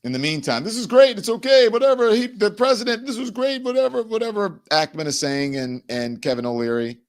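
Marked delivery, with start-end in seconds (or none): 0.6-4.6 high-pitched dismissive parody; mock breeziness. Host mimicking billionaires waving away the crash, mock breeziness. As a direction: rattle off empty reassurance in a raised, mocking voice.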